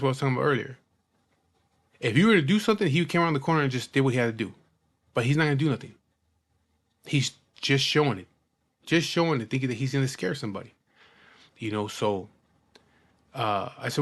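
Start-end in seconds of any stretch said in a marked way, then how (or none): none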